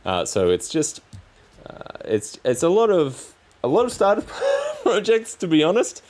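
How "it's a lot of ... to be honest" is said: laughing through the words; chuckling at himself mid-sentence. Real, not acted. Laughing at his own unfinished projects.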